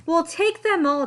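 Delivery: high, loud clown voice; eager clowns. A narrator's reading. Clowns claiming every sweater, high and loud. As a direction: grab it all like thrilled clowns.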